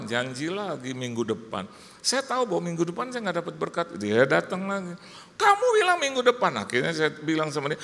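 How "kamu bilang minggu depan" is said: high, loud imitated accusation; accusing yell. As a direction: imitate a collector yelling an accusation. Collector returns accusing him. (tuduhan penagih, tinggi dan keras)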